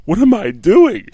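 high, loud and slow, mock disbelief at himself; imitating his own stunned self-doubt; retold disbelief. Former player retelling eating cheesesteaks with the coach, high slow disbelief. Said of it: "And I'm going".

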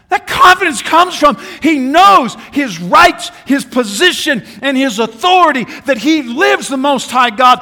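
loud, high, shouted sermon; fiery preaching. Acted. Preacher rousing the congregation, shouting. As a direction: preach it out with full force.

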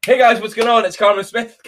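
cheerful live-stream opener; upbeat vlog intro. Retold or imitated. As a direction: launch into the stream like a pro host. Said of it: "until I just went".

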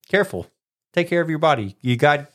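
ordinary delivery

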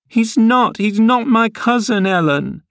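put-on very high, wailing girlish denial; grief-stricken. A narrator's reading. Cathy to Ellen, denying the cousin, distraught. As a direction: protest like a distraught child. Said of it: "gathering fresh grief from reflection".

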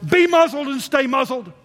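loud, high ringing command; Jesus rebuking the storm with a loud high command. Retold or imitated. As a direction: order the wind to hush. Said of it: "Jesus is saying to this storm".

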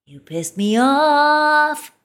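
high, drawn-out. Shown as a demonstration. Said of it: "Do you see the difference here".

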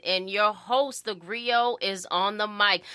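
slow, drawn-out show opener; podcast host intro. Acted. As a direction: announce the show like a radio host.

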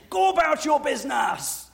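high, loud Barbadian home accent, snapping to end the call; a Barbadian sister ending a phone squabble, dismissive; [name]'s Barbadian accent. Barbadian colleague ends her sister's call, loud, high Barbadian accent. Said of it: "slip into a much, uh, different accent".

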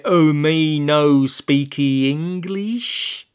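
slow, put-on foreigner act; fake broken English. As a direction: play dumb in pidgin. Olaf feigning broken English.

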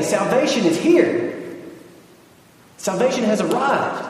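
high-pitched acted proclamation; emphatic declaration. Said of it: "And it was emphatic".

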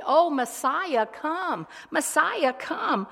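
high, loud, slow cry for Messiah; pleading prayer. Retold or imitated. Preacher voicing the people praying for Messiah, loud pleading. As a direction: call out longingly to the Messiah. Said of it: "And the people prayed".

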